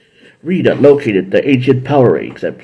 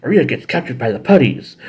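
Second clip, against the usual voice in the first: ordinary delivery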